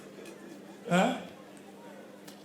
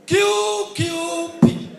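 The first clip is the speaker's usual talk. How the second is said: QQP spelled out slowly, loud; slow drawn-out announcement; 5 semitones higher, 5 dB louder, much slower than usual. Acted. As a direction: drag each letter like a results announcer. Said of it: "announcing uncle's party, slowly".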